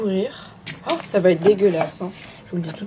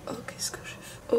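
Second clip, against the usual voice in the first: whispering; 11 dB quieter than usual. Real, not acted. Regret after slime.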